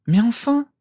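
high, loud exasperated protest. A narrator's reading. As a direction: exclaim in exasperation, rising high. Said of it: "avec moins de véhémence".

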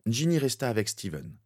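plain reading voice

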